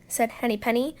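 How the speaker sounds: ordinary delivery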